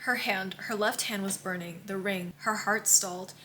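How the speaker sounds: soft, hushed book reading; quiet story narration; reading aloud. A narrator's reading. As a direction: read the quote almost under your breath. Reads tabbed quote aloud.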